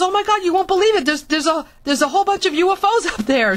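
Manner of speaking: high, rushed retold exclamation; excited mother.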